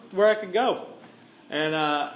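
usual speaking voice

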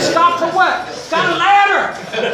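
high-pitched mock shout; calling out. Driver and cousins arguing over a ladder, calling out. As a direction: call it out through a car window. Shouted exchange out car window.

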